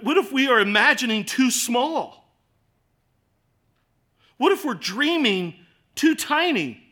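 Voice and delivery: high, loud, driving what-if questions; preaching at full force. Acted. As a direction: preach it out, building with each question. Repeated what-if build-up.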